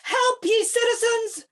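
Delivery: high, loud cry to the crowd; cry for help. Acted. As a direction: rouse the citizens with a cry. Tribune cries to the crowd.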